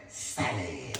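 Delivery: low, soft one-word street slang; Trey. Acted. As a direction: drop it low and cool. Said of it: "That's how he talk".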